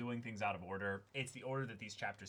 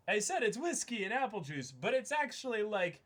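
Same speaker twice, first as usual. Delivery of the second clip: higher, louder laughing admission; excited confession; 10 semitones higher, 6 dB louder than usual. Real, not acted. Host admits the drink is mostly whiskey, giddy and high. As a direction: own up to the strong drink, giddy.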